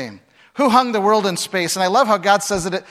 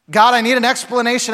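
pleading demand; 6 semitones higher, 5 dB louder than usual. Retold or imitated. Job demanding answers, voiced.

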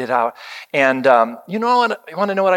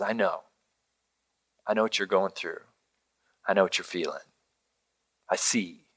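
soft, much quieter, gentle comfort; tender reassurance; 4 semitones lower, 10 dB quieter, faster than usual. Pretend. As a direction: speak it low and tender, like consoling a hurting friend. God's tender words, voiced softly.

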